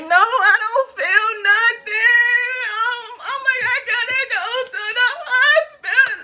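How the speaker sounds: high, loud wail through laughter; half-crying, half-laughing, I gotta go to the hospital; laughing panic wail. Retold or imitated. Retold panic, laughing and wailing.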